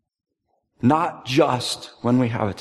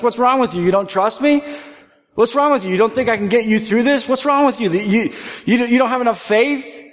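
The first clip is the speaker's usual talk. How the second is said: loud, high, exasperated rebuke; scolding God; 9 semitones higher, 5 dB louder than usual. Retold or imitated. God berating a frightened child, loud and exasperated. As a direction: berate them for their lack of faith.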